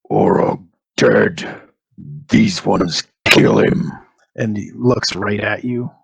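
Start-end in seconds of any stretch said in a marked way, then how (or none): 0.1-3.9 slow, broken orc grunt; a lightly voiced captive orc. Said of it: "And the orc says".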